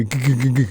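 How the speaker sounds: stuttered car-breakdown rattle; sputtering engine noise. Retold or imitated. Podcaster acting out what the driver hears, stuttering engine noise. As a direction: imitate an engine choking as you drive. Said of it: "you're just driving and just hear".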